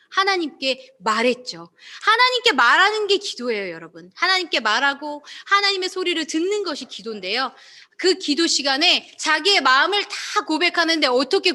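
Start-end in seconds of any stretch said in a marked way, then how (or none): none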